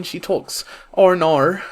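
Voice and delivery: put-on over-the-top Australian accent parody; mock Australian accent. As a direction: lampoon an Australian twang, drawn out. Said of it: "Sorry, Australians, that was probably offensive".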